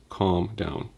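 soft, hushed calming voice; whisper voice. Acted. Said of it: "He needs the whisper voice".

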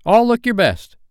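put-on high, loud boyish order; Peter warning. A narrator's reading. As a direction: call the group to attention, high and urgent. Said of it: "Peter warned them".

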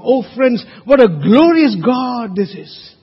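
ordinary talk

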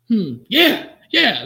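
high, loud mock-delighted yeah; imitated eager agreement. Naked man agreeing he's a lion, imitated eager and loud. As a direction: imitate someone thrilled by the suggestion. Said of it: "Like, he liked the idea".

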